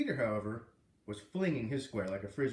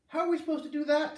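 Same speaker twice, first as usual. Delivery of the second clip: put-on high, loud kid's complaint; whiny kid; 14 semitones higher, 6 dB louder than usual. A narrator's reading. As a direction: whine like a frustrated student. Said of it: "Tommy said".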